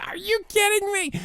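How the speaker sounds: a put-on voice that can't believe the price; incredulous outrage. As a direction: squeak out your shock at the price. Outraged at eight dollar salsa.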